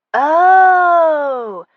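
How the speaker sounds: high, drawn-out loving coo. Acted. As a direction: coo it like at a cute baby. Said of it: "They coo in unison".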